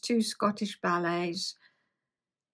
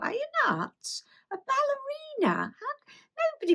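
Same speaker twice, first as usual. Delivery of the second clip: much higher, mock-astonished squeal; incredulous disbelief. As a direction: scoff in shrill disbelief at a silly dream. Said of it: "And I just thought".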